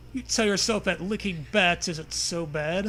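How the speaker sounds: ordinary delivery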